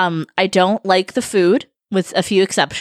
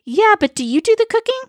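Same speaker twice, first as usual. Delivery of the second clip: skeptical mock question; 12 semitones higher, faster than usual. Mocking holiday lovers.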